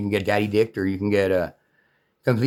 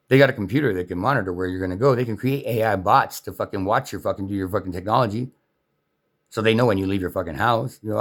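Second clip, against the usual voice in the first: usual speaking voice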